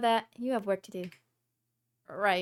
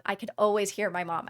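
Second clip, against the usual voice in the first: normal talking voice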